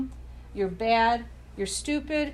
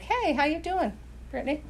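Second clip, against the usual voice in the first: gentle Holy Spirit; 4 semitones higher, 5 dB quieter, faster than usual. Retold or imitated. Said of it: "he'll say something like".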